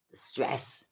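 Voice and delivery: soft whispered repeat of "The stress"; whispered echo. Shown as a demonstration. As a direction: breathe "The stress" as a whispered aside.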